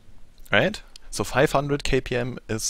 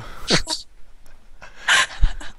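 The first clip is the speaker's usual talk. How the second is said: high laugh. Real, not acted. Laughing at own impression.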